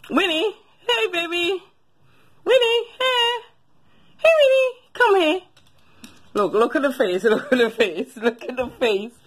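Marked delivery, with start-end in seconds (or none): 0.1-5.4 much higher, whiny-sweet call to the dog. Real, not acted. Calls the dog in pet voice.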